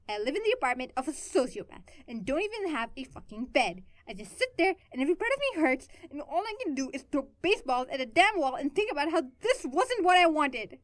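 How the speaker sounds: builds from bitter to loud, slowing at the end; bitter rising anger. A narrator's reading. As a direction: let the bitterness boil up into a cry. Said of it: "she was almost screaming now".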